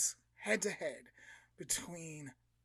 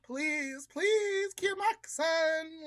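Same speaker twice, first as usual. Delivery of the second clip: rising high, slow desperate plea; high slow praying mom; pleading prayer; 9 semitones higher, 3 dB louder, slower than usual. Acted. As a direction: beg heaven like a frantic mother. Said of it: "mom starts praying".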